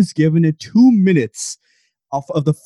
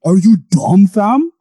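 mock UK menace; 5 semitones higher, 6 dB louder, slower than usual. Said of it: "tell me what you would be more scared by".

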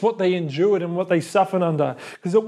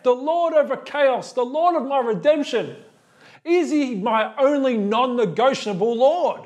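preaching climax; 7 semitones higher than usual. Acted. Preacher building to his closing question, rising proclamation.

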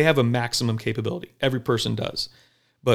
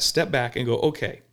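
ordinary talk, nothing marked

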